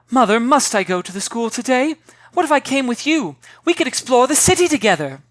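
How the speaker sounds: put-on a dreamy girl begging her mother; eager girl.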